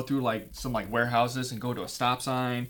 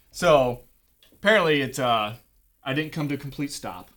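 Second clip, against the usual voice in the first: ordinary talk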